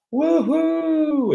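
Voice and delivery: very high, ecstatic woohoo down a slide; thrilled slide whoop; joyful whoop. Retold or imitated. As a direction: whoop like you're flying down a waterslide. Screaming down water slide.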